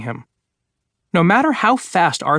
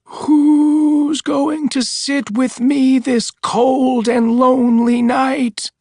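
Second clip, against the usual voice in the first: much higher, very slow moan; moaning ghost voice, closer; 10 semitones higher, much slower than usual. Acted. The voice calls again from closer, slow high moan. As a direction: draw it out, eerier and nearer. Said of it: "heard the voice again".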